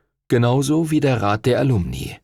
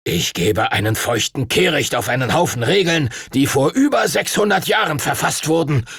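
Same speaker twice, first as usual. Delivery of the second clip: mild raised angry outburst; angry shout; 6 semitones higher than usual. Acted. Duncan scorns the rules before the teachers, heated shout. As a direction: scoff at the old rules, heated. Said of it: "rief Master Duncan wütend".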